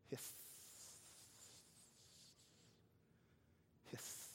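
soft voiceless snake hiss; breathy imitated hiss. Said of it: "what I hear ... hiss".

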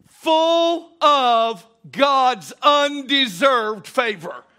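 drawn out. Acted. Preacher drawing out key phrase.

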